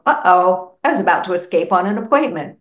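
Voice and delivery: loud startled "uh-oh", rushed; a loud, quick flash of alarm; mock alarm. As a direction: suddenly realize you forgot. Realizing she almost missed appointment.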